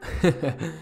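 short, soft acted laugh; a quick scoffing chuckle; mocking laugh. A narrator's reading. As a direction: laugh lightly at a friend's scare. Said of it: "वो तीनों हंसने लगे".

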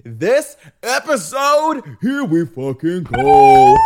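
slow loud hype; hyped. Real, not acted. Host kicking off the episode, slow and loud. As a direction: stretch it out, pumped up.

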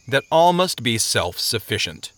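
plain reading voice

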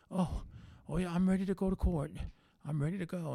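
eager relief. Retold or imitated. Informant suddenly eager to go.

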